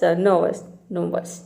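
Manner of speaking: नर्वस with the r silent, slow and soft; soft model. Shown as a demonstration. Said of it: "व स". (नर्वस को र silent रखकर धीरे बोलें)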